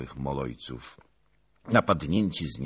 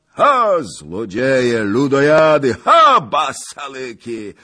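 booming, much higher and louder boast; thundering voice; 13 semitones higher, 9 dB louder than usual. Acted. Zagłoba roaring at the cossacks from the loft, thunderous. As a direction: roar defiance like an old brawler. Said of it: "grzmiący głos pana Zagloby".

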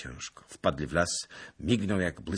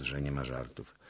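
usual speaking voice

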